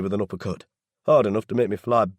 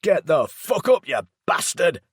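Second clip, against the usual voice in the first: furious yell; 9 semitones higher, 7 dB louder than usual. A narrator's reading. Laz screams at Daryl to get up, raging shout. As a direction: scream it at him with everything. Yelling at stepfather in bed.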